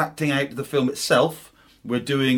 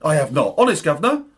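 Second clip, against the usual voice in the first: put-on Cockney accent; 2 semitones higher, 4 dB louder than usual. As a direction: plead innocence like a Cockney. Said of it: "Cockney accents for this".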